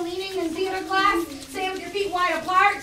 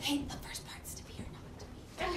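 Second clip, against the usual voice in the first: whispered prompt; teacher whispering the answer to a student; 17 dB quieter than usual. Real, not acted. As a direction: whisper the hint aside.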